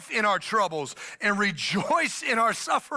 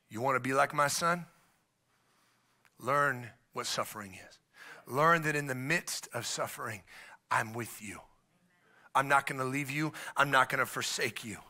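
gentle God voice; 6 semitones lower, 5 dB quieter than usual. Acted. God answering the sufferer.

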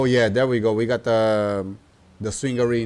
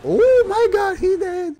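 falsetto cry of dismay; very high, alarmed wail; high shocked squeal; 3 dB louder than usual. Real, not acted. Summoned helper dies.

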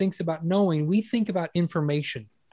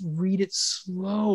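drawn-out slow; 4 dB quieter, much slower than usual. Shown as a demonstration. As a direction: stretch "slow" right out. Shows slow reading by pace.

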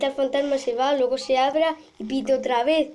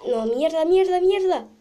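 rising, louder frantic curses; panicked cursing; 5 semitones higher, 4 dB louder than usual. Real, not acted. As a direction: swear in sudden panic. Mistake in game, panicked cursing.

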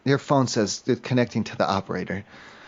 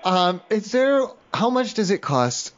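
slow, higher prank-call voice asking the clerk about prices; slow, higher drawn-out ask; 7 semitones higher, slower than usual. Acted. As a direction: stretch each word out slowly, pitched up. Prank caller drags out question.

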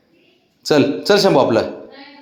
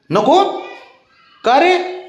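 surprised coaxing question; 12 semitones higher, much slower than usual. Real, not acted. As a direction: pitch jumps much higher, teasing disbelief. Coaxing a child to come along.

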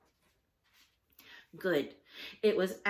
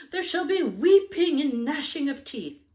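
much higher, louder hammed-up doom; mock prophet of doom; 9 semitones higher, 11 dB louder than usual. Judy relaying the father's threat, hammed-up loud prophecy. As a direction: boom it like a mock preacher.

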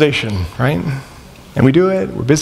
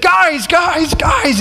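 mock yell; 14 semitones higher, much slower than usual. Man calling across the back of the church, mock yelling. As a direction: imitate a far-off yell without full volume. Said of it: "yelling at the top of his lungs".

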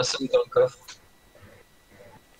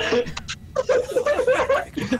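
high laugh. Real, not acted. Caught not listening.

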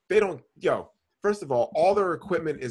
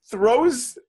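ordinary delivery

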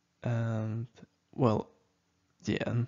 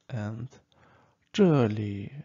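normal talking voice

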